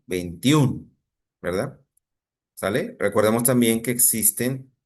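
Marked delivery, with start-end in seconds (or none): 0.0-1.0 "veintiún" with the final Ú stressed and held. Shown as a demonstration. Teacher shows where the accent falls, "veintiún" stressed on Ú. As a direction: lean on the Ú at the end of "veintiún". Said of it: "en la U".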